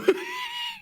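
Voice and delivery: shrill shriek of a laugh; high squealing laugh. Real, not acted. Squeal at coding.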